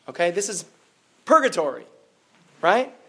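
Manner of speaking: ordinary talk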